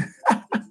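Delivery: short breathy chuckle; quick voiceless laugh; amused laugh. Real, not acted. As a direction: laugh briefly at the request. Amused by accent request.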